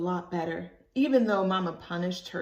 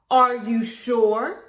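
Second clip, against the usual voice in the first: slow stern question, louder; 4 semitones higher, 10 dB louder, much slower than usual. Acted. Mama doubting Libby, slow and stern. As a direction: press her hard and slow. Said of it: "real stern-like".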